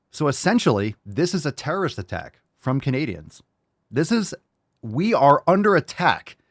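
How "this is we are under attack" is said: high, loud, hammed-up panic; mock alarm. Host joking to viewers that Canadians are attacking, mock panic. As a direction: play it like a fake emergency broadcast.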